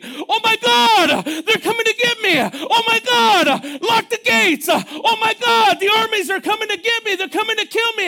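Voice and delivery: high, loud, frantic mock panic; panicked Jehoshaphat. Jehoshaphat panicking about the armies, high frantic panic. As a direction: play the king losing his mind. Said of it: "Jehoshaphat was going crazy".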